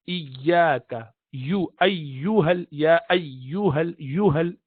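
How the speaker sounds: ayyuhal drawn out slowly, stretching each syllable; slow careful recitation of iyaka and ayyuhal; tajweed demo. Shown as a demonstration. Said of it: "So you would say".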